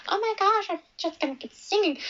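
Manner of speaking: high, fast oblivious chirp; mocking the singer. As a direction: play him clueless and chirpy. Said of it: "He's just, like".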